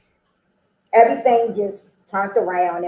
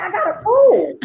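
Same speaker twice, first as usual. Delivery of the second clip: higher, giggly protest; laughing; 5 semitones higher than usual. Retold or imitated. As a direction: laugh at your own innocence. Laughing retelling her own naivety.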